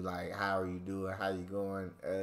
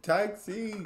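imitated pub call; 9 semitones higher than usual.

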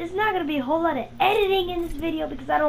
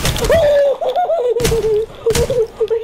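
loud, high burst of laughter; real gleeful laughter; 8 semitones higher, 6 dB louder than usual. Real, not acted. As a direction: laugh out hard in victory. Laughing after the kill.

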